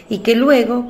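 ordinary talk, nothing marked

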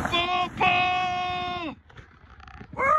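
high thrilled shout; excited shout. Real, not acted. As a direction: yell it mid-ride. Shouting during sled ride.

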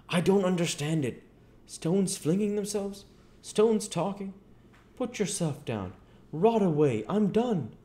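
high-pitched, exasperated tramp voice; bewildered tramp. Acted. Tramp Marvel baffled by flying stones, high and exasperated.

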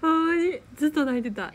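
loud, high, laughing voice; laughing at your own weepiness; laughing confession. Real, not acted. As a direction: blurt it out loudly through real laughter. Amused confession of bawling at films.

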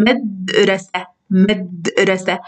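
مدرسة drawn out slowly, syllables apart; slow syllable reading. Shown as a demonstration. Teacher sounding out مدرسة slowly. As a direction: read مدرسة slowly, twice, for the child. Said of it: "هيا نرى الكلمة".

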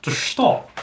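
"stop" with a Dutch-like "sht" sound; Dutch-sounding accent. Shown as a demonstration. Replaying own slip from the announcement, Dutch-sounding accent. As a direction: mimic a slight Dutch accent. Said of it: "Dutch there".

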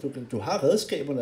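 ordinary delivery

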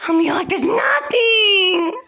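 high, crying complaint; tearful despair. Real, not acted. Despairing that nothing can be done, tearful wail. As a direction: whine it out slowly through tears.

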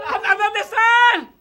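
high, loud, urgent question; frantic searching shout. Acted. Hero asking children where ghosts are, frantic shout. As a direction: cry out, hunting everywhere.

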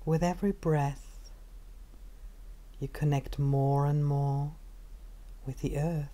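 calm, unhurried meditation guide with long pauses; measured body-scan narration, phrase by phrase; guided meditation. Acted.

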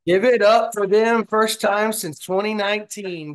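high, loud call for applause; rally the crowd for the team; hyped cheer. Real, not acted. Cheering the team's twenty wins.

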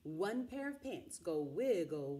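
usual speaking voice

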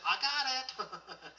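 high, slow exclamation breaking into laughter; triumphant breakthrough. Acted. The heart finally gets it after repetition, triumphant. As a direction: draw it out with sudden glee.

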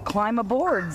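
usual speaking voice

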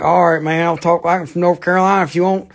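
high, loud, hammed-up North Carolina twang; North Carolina hillbilly accent. Answering the English critics in a loud North Carolina hillbilly accent. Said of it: "talk like a hillbilly like I am".

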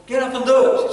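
loud, high-pitched command; urgent order. Acted. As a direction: push them to get up at once. Acted order to a reluctant woman.

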